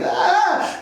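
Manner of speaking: high, drawn-out exasperated ah; mock weary sigh. Dad reacting to his son calling again, high mock sigh. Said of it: "I'm like".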